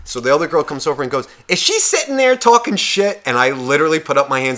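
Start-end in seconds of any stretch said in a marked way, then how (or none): 1.5-3.2 high, accusing retold question; mock confrontation. Girl confronting him over another's trash talk, mock accusing. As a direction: act out a friend demanding answers, pitched way up.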